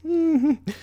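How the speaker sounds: light high giggle; titter. A narrator's reading. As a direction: giggle like a fancy lady. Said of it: "Rarity's titter".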